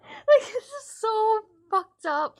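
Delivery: loud, slow, high, cracking up; half-laughing in disbelief. Real, not acted. Recalling the kids' baby-oven game, loud slow laughing disbelief.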